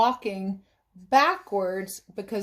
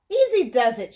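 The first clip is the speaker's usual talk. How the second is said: higher, louder warning to the wind; cautioning; 4 semitones higher, 6 dB louder than usual. Acted. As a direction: warn it firmly but calmly. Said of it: "she cautioned the wind".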